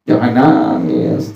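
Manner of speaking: slow, gentle insistence; slow, soft firm answer. Shown as a demonstration. Preacher answers his own question slowly and softly. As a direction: say Jangan nangis slowly and softly. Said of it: "Kita yang ditinggali jangan apa?".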